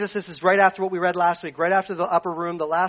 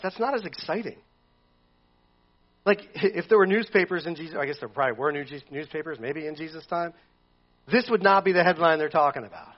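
ordinary talk, nothing marked

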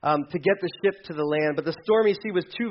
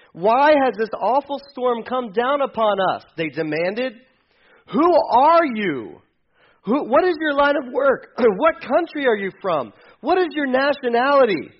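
sailors demanding; 8 semitones higher, 6 dB louder than usual. Acted. Sailors interrogating Jonah.

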